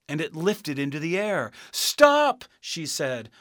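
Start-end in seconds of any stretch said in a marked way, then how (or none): 1.7-2.5 high, very loud cry; shouted. A narrator's reading. Dorothy calls to the rising balloon, shouting. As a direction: shout to halt someone leaving.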